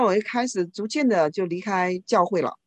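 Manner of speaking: ordinary delivery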